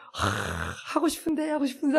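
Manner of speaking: softly, sighing with disappointment; wistful child. Retold or imitated. Child sent to bed without the craft, wistful. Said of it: "너무 아쉬워하면서".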